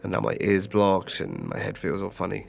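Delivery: ordinary delivery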